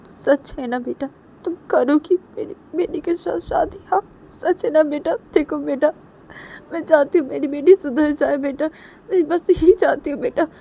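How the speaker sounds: high-pitched weepy mother seeking assurance; tearful hope. Acted. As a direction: ask through tears whether the promise is true. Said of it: "आंखों से फिर से आंसू". (रोती हुई माँ की उम्मीद भरी आवाज़)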